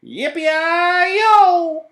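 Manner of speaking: very high, loud cowboy holler; long, high-pitched yippee holler; cowboy shout. A narrator's reading. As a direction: whoop it out like a cowboy. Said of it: "he shouted".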